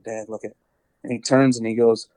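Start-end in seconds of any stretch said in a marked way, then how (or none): 0.0-0.5 hushed. Retold or imitated. Son hushing to his dad in the hunting blind, quiet. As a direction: keep it down, the deer is right there. Spotting a giant buck from the blind.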